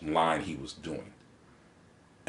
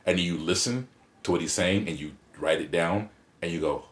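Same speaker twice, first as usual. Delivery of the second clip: ordinary talk, nothing marked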